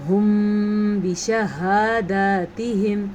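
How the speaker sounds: ھم بشہاداتہم with lips closed and a nasal hum; ikhfa shafawi. Shown as a demonstration. Teacher showing ikhfa shafawi for students. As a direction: hum the meem into the ba. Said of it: "اخفاء شفی کا قاعدہ".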